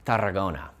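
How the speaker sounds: Tarragona with a tapped Spanish r and pure vowels; Spanish pronunciation. Shown as a demonstration. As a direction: Tarragona said like a local Catalan. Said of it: "or as the locals would call it".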